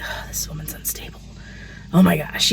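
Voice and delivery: unvoiced aside of people judging her; hushed gossip about the unstable woman; whispered judgment. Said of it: "people are like".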